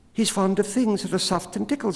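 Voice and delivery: very high, fast squeaky bat voice; tiny creature rushing out the news; the bat, chattery. A narrator's reading. Bat hurries to tell the cat about the baby, high and fast.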